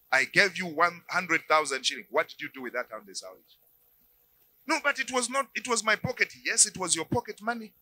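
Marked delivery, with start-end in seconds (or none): 0.1-3.4 nosy husband. Acted. Preacher voices controlling husband.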